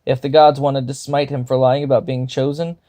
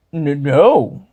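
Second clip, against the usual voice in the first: stammered; 3 dB louder than usual. A narrator's reading. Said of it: "he stammered".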